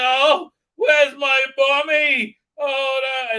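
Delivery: put-on high, loud, slow babyish wail; mock crying child. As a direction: wail like a lost little boy, hammed-up. Mocking son looking for mum.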